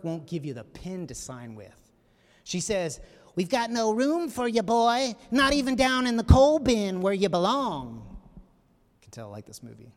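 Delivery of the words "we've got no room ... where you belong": sneering hotel clerk. Retold or imitated. Hotel clerk refuses Jackie.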